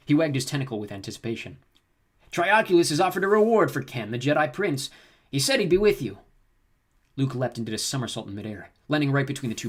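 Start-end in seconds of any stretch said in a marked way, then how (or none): 2.3-6.2 higher, louder gloating thug; smug alien bounty hunter. Acted. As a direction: boast about the bounty. Bounty hunter explains reward.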